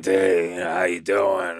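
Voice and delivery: imitating a buddy's New York accent; mimicked New York greeting, stretched out. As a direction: put on a drawn-out New York voice. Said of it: "this New York accent".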